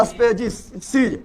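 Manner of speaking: Species stretched out very slowly; slow dictation of species; drawn-out drilling. Shown as a demonstration. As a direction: say species slowly, sound by sound, for the class. Drilling the English term for class.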